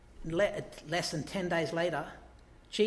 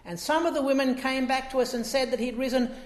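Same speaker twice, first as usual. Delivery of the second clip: higher, louder, bewildered disciples; voicing disciples; 7 semitones higher, 6 dB louder than usual. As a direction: report the news, puzzled. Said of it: "says to them".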